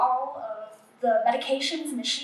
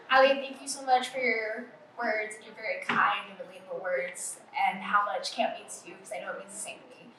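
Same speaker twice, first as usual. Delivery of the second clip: low, fading, choked-up thanks; after crying; 5 semitones lower, 6 dB quieter than usual. Real, not acted. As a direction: trail into a tearful whisper. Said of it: "I'm a little teary-eyed".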